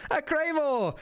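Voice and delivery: high exclaimed greeting; hailing an acquaintance. Acted. Hooded man hails shopkeeper on arriving, high exclaimed greeting. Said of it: "raises his hands and he goes".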